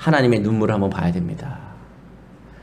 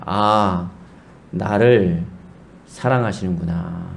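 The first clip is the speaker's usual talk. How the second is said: tender realization; 6 semitones lower, 4 dB quieter, much slower than usual. Retold or imitated. Child realizes father loves him.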